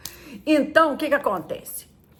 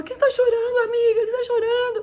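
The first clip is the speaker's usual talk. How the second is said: put-on much higher voice of a friend fussing over a crying girl; worried friend; 13 semitones higher than usual. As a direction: fuss over her like a concerned girlfriend. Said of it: "Ela falou".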